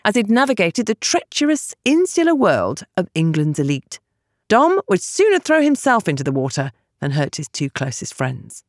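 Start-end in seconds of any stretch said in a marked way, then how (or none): none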